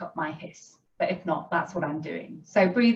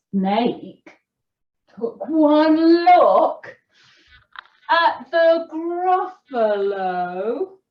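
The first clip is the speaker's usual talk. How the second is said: slow, animated storyteller; story reading to children; 3 semitones higher, 4 dB louder, slower than usual. A narrator's reading. Storyteller reading the Gruffalo to children, animated and unhurried. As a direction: read it slowly with big pitch swoops and pauses.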